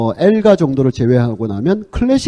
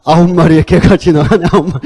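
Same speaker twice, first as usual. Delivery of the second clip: louder recital dissolving into real laughs; laughing; 2 semitones higher, 5 dB louder than usual. Real, not acted. Quoting absurd fan chant lyrics to listeners, breaking up laughing.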